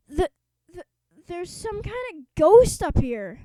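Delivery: a scared cheerleader reporting a ghost; frightened cry. Acted. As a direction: panicky stammer. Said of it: "cried Wendy Swan".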